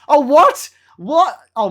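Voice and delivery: sudden high yelp of shock; startled, loud and high; loud surprise. Real, not acted. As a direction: react like the ad caught you off guard.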